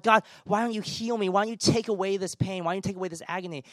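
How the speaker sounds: imitating an anguished appeal, speeding up at the end; crying out. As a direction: plead upward in urgent, repeated questions. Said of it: "we cry out to God".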